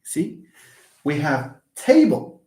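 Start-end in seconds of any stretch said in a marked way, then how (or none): 1.8-2.4 "table" with a strong TA and weak ble; stress on first syllable. Shown as a demonstration. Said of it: "but tay is stressed".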